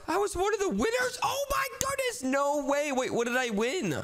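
high-pitched winner's squeal; overjoyed, very high exclaiming; thrilled disbelief. Acted. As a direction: squeal as if you just won. Learns of winning.

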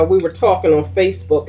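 ordinary talk

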